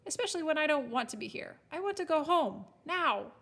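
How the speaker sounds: fed-up, indignant outburst; spitting it out. A narrator's reading. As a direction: demand crossly, building to a firm finish. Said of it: "spat Elsbeth".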